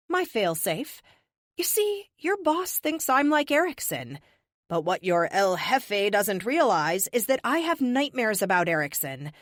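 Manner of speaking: defiant captive. Acted. Captive to the whole room, defiant reveal of her failsafe. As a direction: hold the room like a cocky prisoner.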